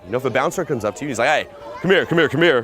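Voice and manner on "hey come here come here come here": louder, higher, insistent bouncer's order. Retold or imitated. Bouncer coming up to a customer, commanding. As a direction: summon them like a bouncer. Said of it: "kinda like a bouncer".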